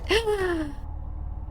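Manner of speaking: high, soft drawn-out gasp. Acted. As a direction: let out a trailing high gasp. Acted shock at the scene.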